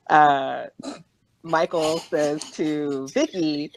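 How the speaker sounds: normal talking voice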